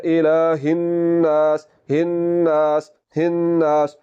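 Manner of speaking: slow "इलाहिन नास" with held n, long aa; ghunna and lengthening. Shown as a demonstration. Teacher showing how to read it, slow drawn-out recitation. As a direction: hum the n, then stretch "नास". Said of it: "पहले गुन्ना किया और फिर".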